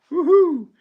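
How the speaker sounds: high loud woohoo; cheering. Real, not acted. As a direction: cheer it out big. Celebrating new member.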